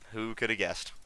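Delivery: ordinary talk, nothing marked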